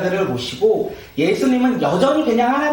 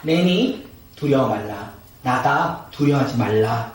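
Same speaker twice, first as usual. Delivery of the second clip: low, measured voice of authority; calm the frightened disciples in a lowered voice; deep, composed reassurance with pauses; Jesus; 7 semitones lower than usual. Acted. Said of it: "예수님께서 그들에게 말씀하세요".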